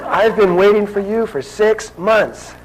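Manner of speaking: high, loud and slow, reproaching; scolding woman. Retold or imitated. Woman reproaching the visitor she waited for, high and slow. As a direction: drag it out like a reproach. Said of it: "she says".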